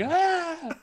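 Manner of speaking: high, loud mock panic; giddy American tourist. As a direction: shriek it like an overexcited tourist. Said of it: "the giddy American tourists that are like".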